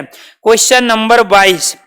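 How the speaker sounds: ordinary talk, nothing marked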